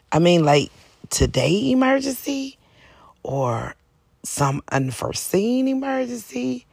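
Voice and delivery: usual speaking voice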